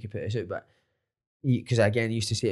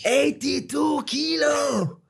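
drawn-out, much higher shout; shouted exclamation; 13 semitones higher, much slower than usual. Real, not acted. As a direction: shout the number in disbelief. Exclaiming his weight.

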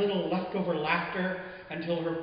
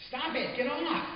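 put-on higher, playful girl's voice pushing him off; laughing protest; 10 semitones higher than usual. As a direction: giggle it out, mock pushing away. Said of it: "she laughs".

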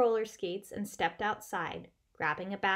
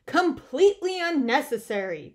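louder, higher, dismissive Bear; firm announcement; 5 semitones higher, 9 dB louder than usual. A narrator's reading. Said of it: "Bear announced".

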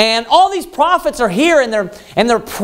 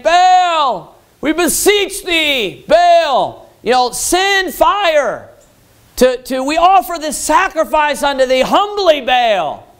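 mock pleading cry; 5 semitones higher, slower than usual. Prophets of Baal crying to their god, high mock wail. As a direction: beg the idol like a desperate priest. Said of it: "yelling out".

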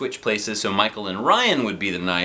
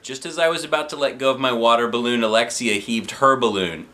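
plain reading voice

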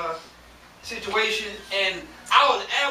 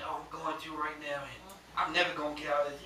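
discouraged; 6 semitones lower, 8 dB quieter than usual. Acted. Voices a discouraged member's private thoughts.

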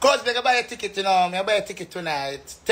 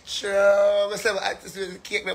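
ordinary delivery

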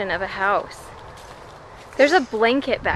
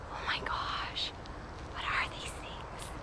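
whisper; 16 dB quieter than usual. Real, not acted. Mother whispering in awe at the creatures in the field. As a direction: keep it down, awed and hushed. Hushed after telling kid shh.

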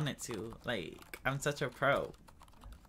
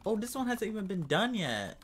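usual speaking voice

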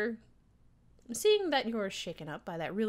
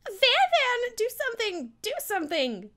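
frantic yell; 8 dB louder than usual. Real, not acted. Player yells at character for help.

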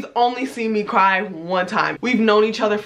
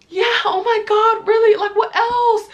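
high, loud, fake-interested replies; imitated distracted friend; 11 semitones higher, 3 dB louder than usual. Tammy texting while she listens, high distracted responses. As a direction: feign interest at a high pitch.

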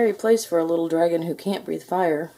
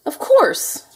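high, loud exclamation; knight's excited eureka; sudden realization; 10 semitones higher, 7 dB louder than usual. A narrator's reading. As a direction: burst out as the answer hits. Knight suddenly finds the answer.

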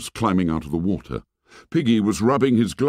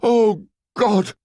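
high, loud anguished cry; agonized cry; 13 semitones higher, 3 dB louder, slower than usual. A narrator's reading. Ralph torn between ship and fire, crying out in anguish. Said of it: "Ralph cried out".

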